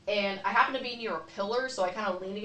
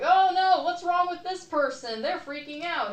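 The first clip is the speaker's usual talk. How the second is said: much higher, loud mock panic; mock alarmed onlookers; 9 semitones higher, 5 dB louder than usual. Imitating bystanders alarmed at her breakdown, high mock panic. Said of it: "people are like".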